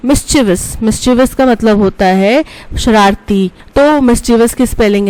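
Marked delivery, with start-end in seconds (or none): none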